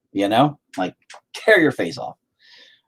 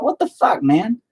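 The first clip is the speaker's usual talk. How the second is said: indignant; 9 semitones higher than usual. Retold or imitated. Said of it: "I'm like".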